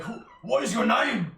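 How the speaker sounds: high loud urgent question; startled orc. Acted. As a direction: ask in alarm. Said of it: "the other guy's like".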